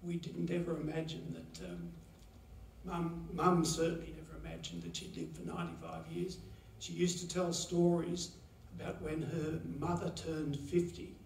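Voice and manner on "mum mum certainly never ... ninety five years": ordinary delivery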